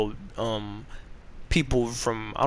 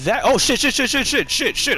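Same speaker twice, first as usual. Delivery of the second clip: rapid-fire panicked cursing, high-pitched; 9 semitones higher, 4 dB louder than usual. Real, not acted. Player caught out mid-fight, panicked. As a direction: blurt it over and over in a panic.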